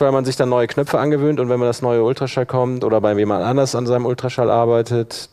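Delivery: ordinary delivery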